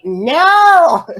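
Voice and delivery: a high, loud retold protest; mock yell. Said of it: "And I'm like".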